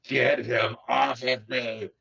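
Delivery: slow, drawn-out mock fury; put-on exasperated growl of protest; irate. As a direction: imitate an irate man, without raising volume. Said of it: "and is just irate".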